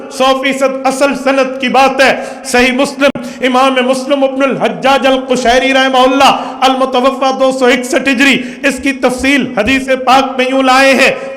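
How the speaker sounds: loud, high-pitched sermon delivery; impassioned preacher in full flow; full-force preaching. Real, not acted. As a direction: drive each point home to the congregation.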